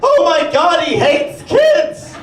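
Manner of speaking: put-on high, loud shocked old woman's cry; Grandma yelling. Grandma yelling across the Thanksgiving table, high and shocked. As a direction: shriek it like a scandalized grandma. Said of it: "Grandma yells".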